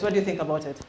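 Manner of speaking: ordinary talk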